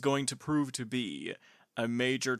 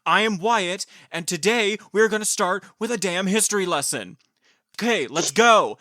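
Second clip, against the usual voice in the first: excited intro; 5 semitones higher, 9 dB louder than usual. Acted. Hyped-up podcast intro.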